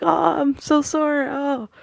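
high, pained mock moan; whiny groaning wrestler. Aiden after a beating, mock moaning in pain. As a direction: ham up a sore, sorry-for-himself whimper. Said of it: "And Aiden's just like".

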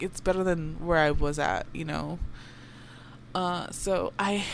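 normal talking voice

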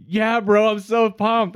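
loud, high-pitched parody of excitement; mock pumped-up. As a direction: imitate a hyped bro, high and loud. Retold pumped friend, much higher and louder.

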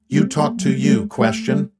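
an alien friend scolding, word by word; Rocky alien voice. Acted. Rocky speaks.

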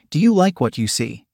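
plain reading voice

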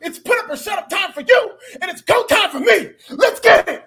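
high, loud mock-tough promo; hammed-up suburban tough-guy read. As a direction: hype it up in a squeaky suburban voice, yelling. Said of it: "I'm gonna read this in my suburban voice".

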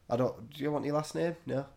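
ordinary talk

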